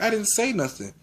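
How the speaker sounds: high-pitched mock denial; retold protest. Accused student answering the teacher, high retold protest.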